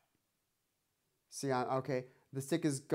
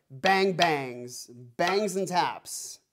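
slow, emphatic bang bangs and taps; drawn-out naming; 3 semitones higher, 5 dB louder, much slower than usual. Shown as a demonstration. Teacher renaming accents and taps for student, slow and emphatic. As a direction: stretch out bangs and taps slowly. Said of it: "Or we could call it".

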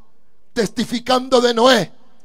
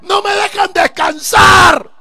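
an annoyed inmate ranting about the noise, loud and higher; angry complaint; 6 semitones higher, 5 dB louder than usual. Retold or imitated. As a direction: rage about the drums. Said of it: "Así yo hablaba".

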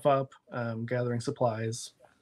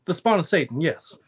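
louder, booming preacher; 4 semitones higher, 7 dB louder than usual. Acted. GM as preacher answering Jack, booming. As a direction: declare it like a preacher from the pulpit.

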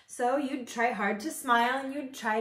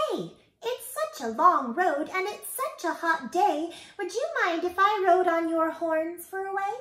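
high, louder bug voice; bug calling out; 8 semitones higher, 4 dB louder than usual. A narrator's reading. Bingle bug asks the moose for a ride, calling out. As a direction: call up high to a passing moose. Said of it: "The bug called out".

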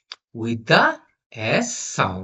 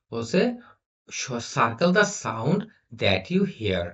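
normal talking voice

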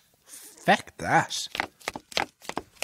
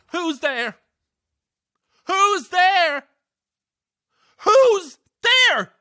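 rising panicky who's there, higher each time; frightened calling; much slower than usual. Acted. Alone in the dark, calling to an unseen prankster, rising panic. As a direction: call out into the dark, more scared each time.

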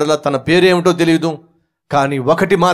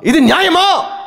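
angry shout; 10 semitones higher than usual. Acted. Wife protesting to husband.

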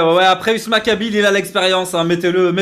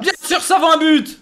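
excited shout; 9 semitones higher than usual. Real, not acted. Co-host cheering the goal, high excited shout. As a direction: yell it like the goal just went in.

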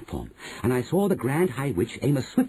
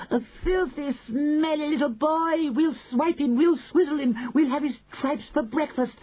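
very high screechy crowd of witches; witches crying out. A narrator's reading. Witches plotting against a smelly boy, high cries. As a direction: squeal it as an eager witch mob. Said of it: "cried the witches".

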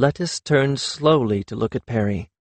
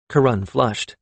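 usual speaking voice

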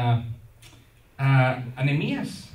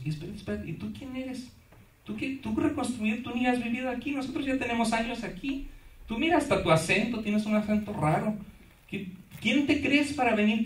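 high, soft, scornful townsfolk voice; mocking sneer; 6 semitones higher, 4 dB quieter than usual. The Jerusalem locals jeering at Nehemiah, high soft mockery. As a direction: sneer at the outsider, higher and softer.

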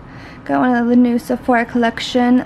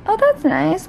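normal talking voice

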